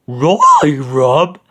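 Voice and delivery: a frightened cartoon dog echoing; Scooby crying out. A narrator's reading. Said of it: "Scooby cried".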